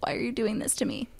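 pitiful whispering plea; whispered dog voice. Retold or imitated. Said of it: "He's like".

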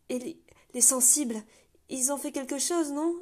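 plain reading voice

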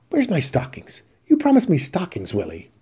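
high, shrill-pitched demand; the woman insisting on her gift. Acted.

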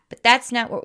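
ordinary talk, nothing marked

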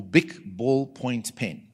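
slow, deliberate Bic ballpoint pen; calm model line for the class; slow demo phrase. Shown as a demonstration. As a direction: spell out each word slowly. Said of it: "say it quietly inside".

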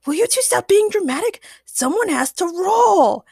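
high, loud exasperated outburst; impatient. A narrator's reading. Chad scolds his dramatic friends to keep playing, impatient. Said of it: "Chad grew impatient".

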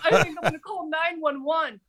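high-pitched mock panic; retold hysteria. Retelling panicked call to a guy, mock hysterical. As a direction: imitate yourself panicking, voice pitched right up. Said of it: "I'm hysterical".

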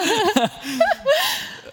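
high teary laugh breaking out; a sudden high laugh covering tears; high emotional laugh. Real, not acted. Emotional laugh about heartbreak.

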